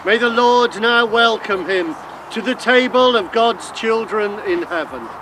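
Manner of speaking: normal talking voice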